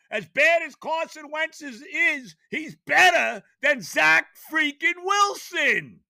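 high, loud, slow furious shout. Real, not acted. Fan raging at his quarterback, furious shout. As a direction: spit out the name.

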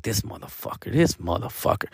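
hushed, half-whispered grumble under the breath; muttered curse. Retold or imitated. Angry man muttering under breath.

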